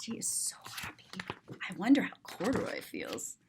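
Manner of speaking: fast whispered aside; soft whisper. Acted. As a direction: whisper her happiness. Tender aside about the girl.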